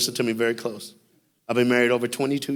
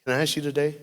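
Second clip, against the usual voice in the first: soft altar call; 3 semitones lower, 8 dB quieter than usual. Real, not acted.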